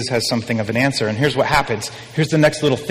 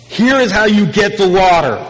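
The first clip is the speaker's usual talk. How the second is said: higher, louder, grand pronouncement; a declared answer, raised and loud; emphatic announcement; 6 semitones higher, 5 dB louder than usual. Acted. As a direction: announce it like a big reveal.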